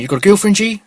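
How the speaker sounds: high, loud and pushy; loud street stranger. Retold or imitated. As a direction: fire the question up high and loud. Stranger asks about girlfriend.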